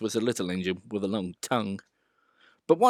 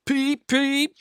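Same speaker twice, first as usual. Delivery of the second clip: high, cross little toots; angry whistle; 13 semitones higher than usual. A narrator's reading. Thomas stuck at a signal, mildly angry whistle. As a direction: blow a crabby engine whistle. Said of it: "he blew an angry".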